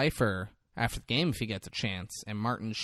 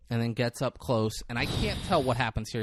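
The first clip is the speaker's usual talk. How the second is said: ordinary talk, nothing marked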